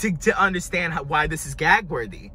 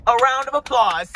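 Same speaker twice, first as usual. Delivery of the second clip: louder, higher lyric call; 4 semitones higher, 5 dB louder than usual. Retold or imitated. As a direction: call out the line like the diva on stage. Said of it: "She said".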